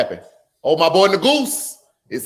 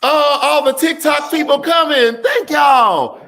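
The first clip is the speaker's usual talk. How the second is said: a streamer shouting welcome to TikTok viewers joining the stream; calling out to viewers; 6 semitones higher, slower than usual. Real, not acted. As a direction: hail the new arrivals at the top of your voice.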